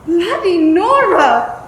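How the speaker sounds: loud shocked yelp at icy water; cold shock shout. Acted. Mother gasping at the cold sea, loud yelp. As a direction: shriek as the cold water hits.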